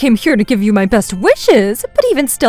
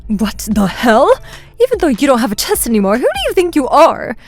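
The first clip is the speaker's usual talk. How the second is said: raised voice; 4 semitones higher, 6 dB louder than usual. A narrator's reading. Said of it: "started to shake and raise her voice".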